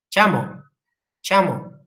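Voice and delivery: normal talking voice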